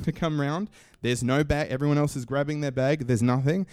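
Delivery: ordinary talk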